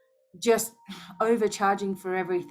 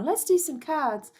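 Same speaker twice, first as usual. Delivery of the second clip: high, mock-cheerful sing-song; a mocking, higher, chirpy tone; mocking her old self; 8 semitones higher than usual. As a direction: parody a forced-positive card reader. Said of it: "I can't sit here anymore".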